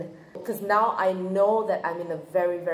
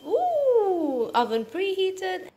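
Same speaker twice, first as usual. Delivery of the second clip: high, bright cheerful note; chirpy kitchen delight; excited; 12 semitones higher, 6 dB louder, much slower than usual. Acted. Cheerful cooking commentary.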